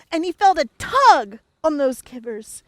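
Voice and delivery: usual speaking voice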